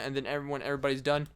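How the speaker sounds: usual speaking voice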